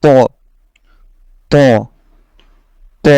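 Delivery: "ত" heavy, with rounded mouth; thickened to. Shown as a demonstration. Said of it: "একটু মোটা করে আপনাকে পড়তে হবে". (মুখ গোল করে মোটা "ত")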